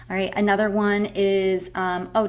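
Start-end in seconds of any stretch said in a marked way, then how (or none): none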